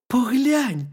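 high, loud hedgehog voice; hedgehog character. A narrator's reading. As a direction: urge the bear as a lively little hedgehog. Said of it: "сказав їжачок".

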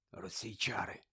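whispered spell, almost no voice. Acted. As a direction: breathe the incantation softly. Spell cast over Krum.

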